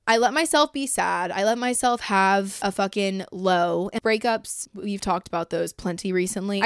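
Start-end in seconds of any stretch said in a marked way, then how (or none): none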